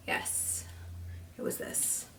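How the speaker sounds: soft voiceless whisper. Acted. Killer admitting his reason to the listener, whispered. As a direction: breathe it out as a confession. Revealing the eye obsession.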